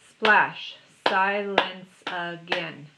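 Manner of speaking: "splash, silence again" slowly, beat by beat; "splash, silence again" in five separate beats; counted syllables. Shown as a demonstration. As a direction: punch "splash", then space out "silence again". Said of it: "Five syllables".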